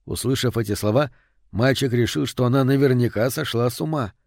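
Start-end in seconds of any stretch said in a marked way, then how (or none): none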